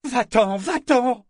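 frightened cry. A narrator's reading. Vivacia crying out at the serpent in terror. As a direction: scream it away. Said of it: "cria-t-elle au serpent".

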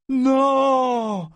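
high, loud, drawn-out denial; crying out. A narrator's reading. Figurehead refusing what the pirate said, crying out. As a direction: cry out in outrage. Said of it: "s'écria-t-il".

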